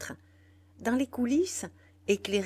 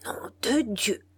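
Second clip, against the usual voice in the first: exasperated oath; 3 semitones higher, 4 dB louder than usual. A narrator's reading. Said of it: "cria Bordenave exaspéré".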